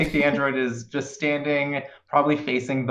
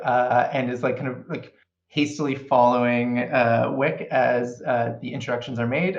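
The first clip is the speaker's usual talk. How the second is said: normal talking voice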